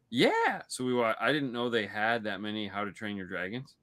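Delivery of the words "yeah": put-on very high, excited kid's yes; eager four-year-old. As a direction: squeal agreement like a small child. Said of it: "He's four".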